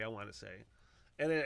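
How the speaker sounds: ordinary talk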